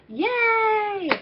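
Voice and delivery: a high, bright burst of a cheer; excited yay. Real, not acted. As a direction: cheer it like a little celebration. Cheering new coaches joining.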